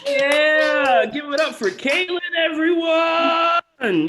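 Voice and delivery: loud whooping host call; hype shout. Real, not acted. Host to the crowd, loud whooping applause call. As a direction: pump the crowd up for Caitlin. Host hypes comedian offstage.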